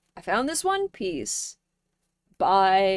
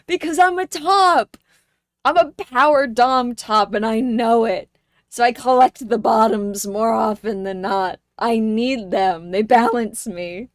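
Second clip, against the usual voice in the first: mock boast; 2 semitones higher, 8 dB louder than usual. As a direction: announce it like a swaggering brag. Boastful joke to chat.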